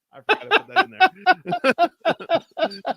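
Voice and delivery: high, loud laughter through words; laughing. Real, not acted. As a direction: crack up while talking. Bursts out laughing at own text.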